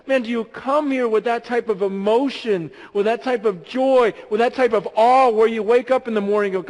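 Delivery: loud, high-energy sermon; impassioned pastor; preaching. Acted. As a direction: drive the message home to the church. Preaches at full force.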